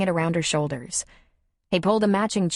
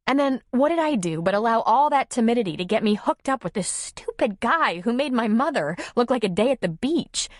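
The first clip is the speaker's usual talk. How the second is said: high, animated woman's voice; exasperated Nora; 8 semitones higher than usual. Acted. Nora admitting she only acts brave, exasperated. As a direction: confess it in a high, self-mocking rush. Character dialogue.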